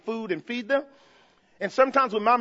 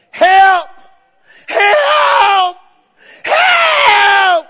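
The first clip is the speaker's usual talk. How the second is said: high, very loud long shout; desperate cry for help; 8 semitones higher, 11 dB louder, much slower than usual. Acted. Preacher enacting Jehoshaphat crying out to God, screaming for help. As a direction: scream it out, stretching the word. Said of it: "That's how Jehoshaphat cried out".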